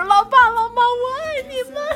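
high, loud shout of love to parents; reenacted midair shout to mom and dad; retold joyful yell. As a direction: yell it out from the sky, half laughing. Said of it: "第一句喊就是".

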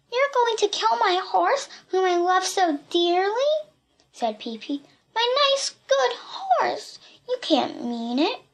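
put-on high-pitched, pleading girl's voice; distressed girl. A narrator's reading. Voices girl fearing for horse.

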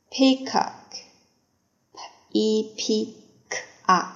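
usual speaking voice

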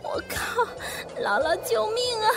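high, wailing tantrum; crying scream. A narrator's reading. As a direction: scream it out in tears. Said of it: "何木子哇地哭了，喊". (哭喊)

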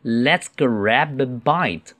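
slow, carefully drawn-out model reading. Shown as a demonstration. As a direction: read it slowly for learners. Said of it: "慢读一次".

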